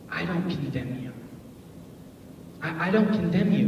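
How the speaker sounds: quiet, gentle repeat; soft reassurance. Retold or imitated. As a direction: comfort her softly. Repeated gentle words of Jesus.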